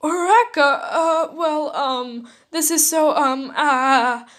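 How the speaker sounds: put-on flustered boy stammering; flustered stutter. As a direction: stammer after being kissed. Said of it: "Izuku stuttered".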